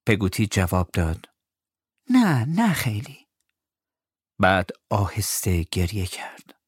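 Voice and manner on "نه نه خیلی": gentle reassurance. Acted. Peggotty answering gently.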